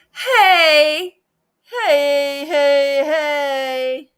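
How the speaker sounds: loud, high hey; loud, excited hey, hey, hey!; excited greeting. Real, not acted. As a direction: greet the arriving friend with joy. Guest joins call.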